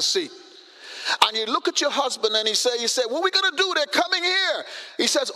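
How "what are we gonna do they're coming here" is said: higher-pitched mock panic; urgent, worried question pitched up high; acted alarm. Wife to husband as gunmen approach, alarmed.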